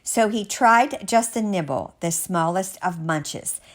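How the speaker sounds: ordinary delivery, nothing marked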